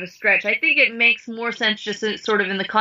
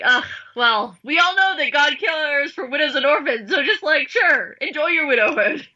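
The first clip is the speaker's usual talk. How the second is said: breezy mock brush-off, pitched up; sigh, then toss it off sarcastically; hammed-up dismissive retort; super dismissive; 4 semitones higher than usual. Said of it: "super dismissive response".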